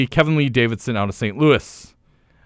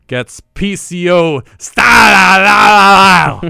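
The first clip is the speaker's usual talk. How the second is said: slow, drawn-out booming shout; hype announcer shout; 4 semitones higher, 7 dB louder, much slower than usual. Acted. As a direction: stretch it out like a ring announcer. Big wrestling show announcement.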